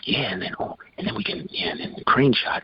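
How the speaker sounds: soft, half-whispered planning; huddled whisper. Retold or imitated. Producers huddling over scenes, a soft whisper. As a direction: murmur like producers conferring in a huddle. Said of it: "they'd huddle, and they'd talk".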